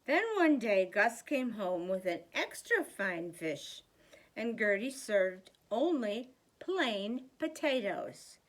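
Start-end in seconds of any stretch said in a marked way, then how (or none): none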